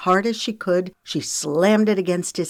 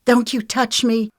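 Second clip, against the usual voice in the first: high, angry warning to back off; hissed warning; 7 semitones higher, 4 dB louder than usual. A narrator's reading. Lexi knocks Wiley's hand away, hissed warning. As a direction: warn him off, pitched up. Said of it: "she hissed".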